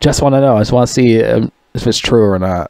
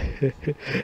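airless wheezing laugh; a breathless, soundless chuckle; silent breathy laugh; 2 semitones higher, 13 dB quieter than usual. Real, not acted. Wheeze-laughing at a funny video.

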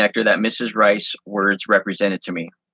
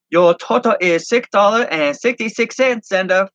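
much higher, chirpy cashier voice in broken English; 12 semitones higher, 3 dB louder than usual. Acted. Shop owner's daughter Ling ringing up Zenda, high and chirpy. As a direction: ring him up brightly in broken English. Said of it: "said in broken English".

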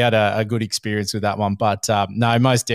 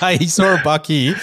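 higher, louder jokey voice with a laugh in it; mock conspirator; 6 semitones higher, 4 dB louder than usual. Imitating Hurls sending Michael to the buck, laughing. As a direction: ham up the mate tipping someone off.

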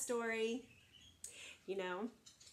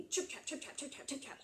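rapid, light patter; quick little footsteps; 3 semitones higher, 7 dB quieter, faster than usual. Acted. Littlest goat scurrying all the way across, quick and light. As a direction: rattle it off like tiny hooves.

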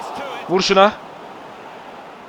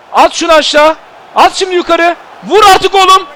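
higher, louder shouts at the players; frustrated yelling; 9 semitones higher, 6 dB louder than usual. Real, not acted. Gamer urging players to score, frustrated yelling. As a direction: yell urgently at your team.

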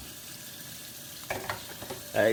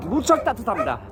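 upbeat announcer; 8 semitones higher, 4 dB louder than usual. Real, not acted. Opening outdoor weather remark.

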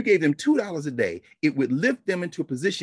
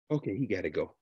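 low, hushed plotting voice; private room aside; 10 semitones lower, 8 dB quieter than usual. Acted. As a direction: drop it right down, off the record. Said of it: "Now in the room, you said".

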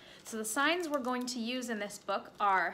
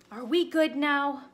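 ordinary delivery, nothing marked

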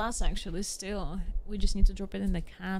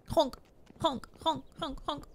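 goose honks; 5 semitones higher than usual. Pretend. Honking like a goose.

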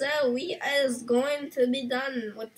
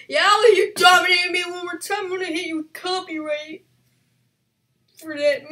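higher, louder aggrieved yell; frustrated shout; 5 semitones higher, 5 dB louder than usual. Real, not acted. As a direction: shout at the winner. Outburst after being killed.

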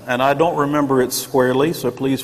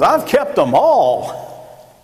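put-on slow, higher, smug little-boy voice; Boy Scout Pharisee; 6 semitones higher, 3 dB louder, much slower than usual. Pharisee boasting to Jesus, slow and smug. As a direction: drag it out like a proud boy scout. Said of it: "Boy Scout Pharisee who goes".

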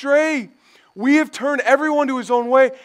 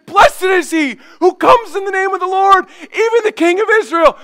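higher, louder jubilant cry; excited hailing; 7 semitones higher, 5 dB louder than usual. Retold or imitated. Crowd hailing Jesus as king, jubilant. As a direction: hail the king with a crowd's fervour. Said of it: "they can go from saying".

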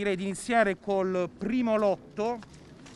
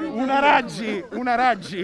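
loud auctioneer call; 7 dB louder than usual. Pretend. Auctioneer repeating bid loudly.

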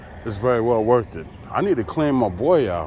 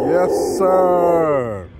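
playful dog voice; 5 semitones higher, 4 dB louder than usual. Pretend. Answering for the dog.

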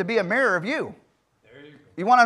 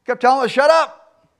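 usual speaking voice

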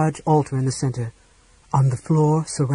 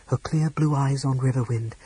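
usual speaking voice

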